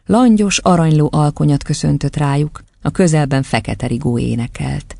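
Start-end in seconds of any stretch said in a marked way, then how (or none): none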